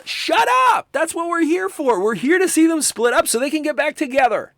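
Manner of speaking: sudden high, loud outburst at a chatty viewer; high, loud, fed-up rant; exasperated shout. Acted. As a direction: snap at the person talking behind you. Scolding people who talk during films.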